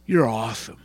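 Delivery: low, soft, reverent praise; hushed prayer. Pretend. The right way at sunset, a soft praise to God. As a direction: speak it quietly to God, in awe.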